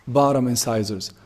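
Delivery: ordinary talk, nothing marked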